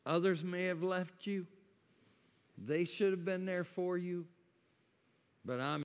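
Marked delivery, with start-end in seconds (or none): none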